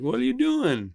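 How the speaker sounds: high-pitched imitation of disbelief; mock incredulous challenge. Rival fans confront him after game, mock incredulous. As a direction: question it as an appalled fan would.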